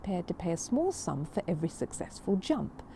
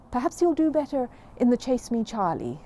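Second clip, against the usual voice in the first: hopeful; 5 semitones higher, 5 dB louder than usual. A narrator's reading. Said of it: "said Jonathan hopefully".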